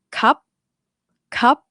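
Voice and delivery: "cup" short and high, stopped dead; abrupt dead syllable. Shown as a demonstration. Teacher shows what cannot be stretched, abrupt stop. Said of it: "you cannot stretch the end".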